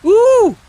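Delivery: very high, loud "ooh"; drawn-out squeal of glee; delighted squeal. Acted. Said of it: "went on bouncing and saying".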